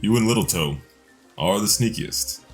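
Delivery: ordinary talk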